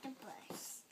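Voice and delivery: breathy whispered words. Real, not acted. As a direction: whisper it softly. Toddler says it unvoiced.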